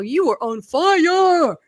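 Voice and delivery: loud, high and drawn-out exclamation; excited praise. Real, not acted. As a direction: gush it out slowly at full volume.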